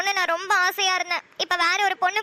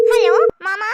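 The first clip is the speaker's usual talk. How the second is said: loud hello; 8 dB louder, slower than usual. Acted. Cheerful greeting on phone.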